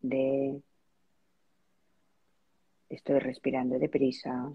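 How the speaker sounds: spacious, measured relaxation-practice voice; guided meditation. Real, not acted. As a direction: lead them gently into noticing their breath, unhurried. Leads a breathing meditation.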